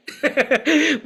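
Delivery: loud, high burst of laughter; a laugh at her own joke; laughing about living in church to dodge debt collectors. Real, not acted.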